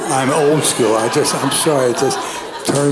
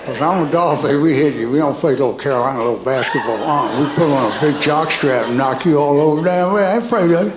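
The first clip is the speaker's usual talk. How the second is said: Georgia football player; 4 semitones higher than usual. Retold or imitated. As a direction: hype yourself up like a swaggering linebacker. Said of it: "My imitation of a Georgia football player".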